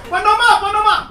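put-on loud high pleading child; kid calling teacher. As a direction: call the teacher urgently like a schoolboy.

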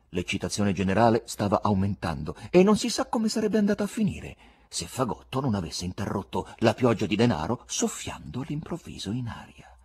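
usual speaking voice

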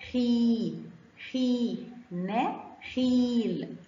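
خي and نخيل with a long, slow ii; long ii vowel. Shown as a demonstration. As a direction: draw out the ii in خي and نخيل. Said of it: "خاء كسرة ومد بالياء".

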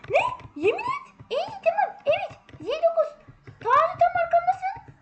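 thrilled that friend sits right behind; excited disbelief. Real, not acted. Gamer squealing to friend about their seats, excited. Finds friend's seat is next.

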